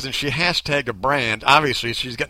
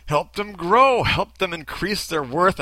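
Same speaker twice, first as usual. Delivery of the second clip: ordinary talk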